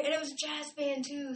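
usual speaking voice

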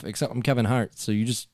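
ordinary delivery

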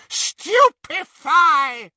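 high, drawn-out shouted curse; screamed spell. A narrator's reading. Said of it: "she screamed".

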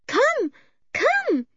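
put-on very high girl's voice; high, insistent child's plea; urgent Eily. A narrator's reading. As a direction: urge them in short high calls. Eily urgently drags Julian away.

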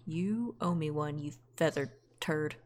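low, slow grumbling jab; quiet threat. Acted. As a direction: mutter it slowly at the bird. Character scolds companion bird.

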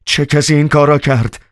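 high, alarmed yell; a sudden frantic shout at the governor; wild shout. A narrator's reading. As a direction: cry it out wildly in shock. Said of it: "وحشیانه به اطراف نگاه کرد و فریاد زد".

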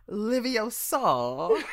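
high, loud, drawn-out mocking mimicry; Ron. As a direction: stretch it out to make fun of her. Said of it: "Ron, you know, making fun of her".

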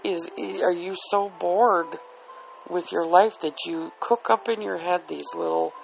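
ordinary talk

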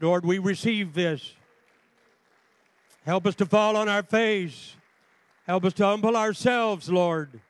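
loud, high pleading prayer; fervent prayer. Real, not acted. Preacher asks the Lord to help them humble themselves, fervent. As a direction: cry out to God on the congregation's behalf.

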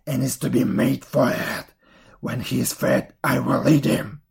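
loud, put-on witch voice; crying witch. A narrator's reading. Witch rousing Gretel to cook for Hansel, loud and commanding. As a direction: order her about as the wicked witch, forcefully. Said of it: "and cried".

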